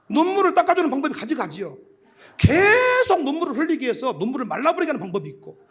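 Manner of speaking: usual speaking voice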